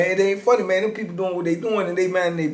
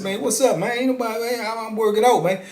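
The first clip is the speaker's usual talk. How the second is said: higher-pitched casual brush-off; Harvey brushing it off; 8 semitones higher than usual. Retold or imitated. Harvey shrugging off the angry inmate, higher, easy brush-off. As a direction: play it innocent and dismissive, pitched up.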